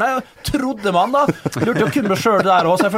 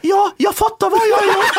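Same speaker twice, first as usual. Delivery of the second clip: much higher, squeaky-sounding meek answer; Erlend Ormbøstad's high voice; 13 semitones higher, 4 dB louder than usual. Retold or imitated. Young player answering the coach meekly in a much higher voice. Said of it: "med, med lys stemme".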